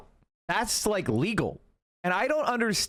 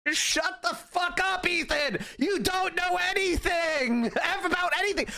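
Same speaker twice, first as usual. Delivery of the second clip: mock shout; 9 semitones higher than usual. Mocking rant at Ethan.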